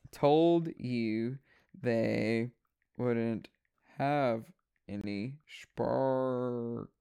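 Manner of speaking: word by word, soft and low; drawn-out soft deliberate repeat; slow, separated words. Real, not acted. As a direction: spell it out slowly, quietly, pause between words. Slowly repeats an aside to someone.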